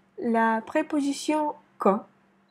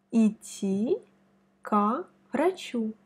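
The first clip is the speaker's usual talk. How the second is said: slow, careful Russian demo; slow model phrase; slower than usual. Shown as a demonstration. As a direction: say it slowly for the learner. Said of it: "Analysons les exemples de cet emploi". (lentement, comme un modèle)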